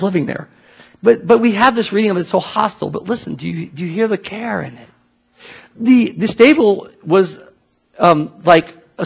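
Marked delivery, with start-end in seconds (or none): none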